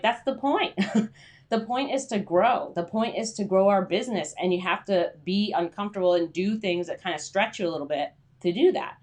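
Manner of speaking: ordinary delivery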